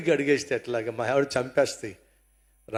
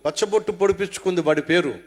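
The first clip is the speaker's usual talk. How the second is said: normal talking voice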